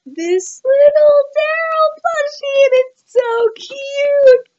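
high, slow, gushing voice; cutesy squeal. Real, not acted. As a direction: squeal it like it's adorable. Gushing over plushie.

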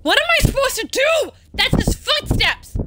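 high, panicked cry; real panic. Real, not acted. Player hearing his footsteps, panicked high cry. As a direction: cry out as the threat closes in. Hears the stalker's footsteps in game.

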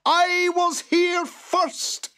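high, loud, slow creature yell; furious boggart screech. A narrator's reading. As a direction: shriek the order, stretching each word. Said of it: "yelled the boggart".

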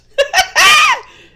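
sudden shrieking laugh, high and loud; real laugh bursting out; loud burst of laughter. Real, not acted. Reader cracking up at her own word.

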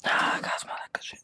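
whispered. Real, not acted. Whispered reaction.

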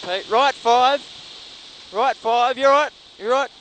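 loud, urgent co-driver calls; rally pace notes. Real, not acted. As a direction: bark out corner calls over the engine.

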